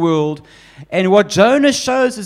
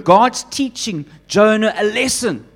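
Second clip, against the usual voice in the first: normal talking voice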